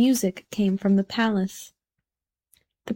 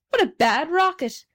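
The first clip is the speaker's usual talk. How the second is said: mild exclamation, pitched up; a workman's lightly voiced cry of disapproval; cried out; 6 semitones higher than usual. A narrator's reading. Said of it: "he cried".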